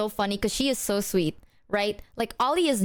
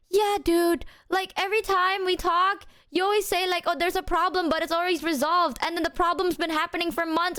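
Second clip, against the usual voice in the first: higher-pitched aggrieved friend impression; imitated growing upset; 7 semitones higher than usual. Friend confronting her about hidden problems, imitated as getting angrier. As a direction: mimic a friend airing built-up grievances. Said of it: "And then she gets angrier".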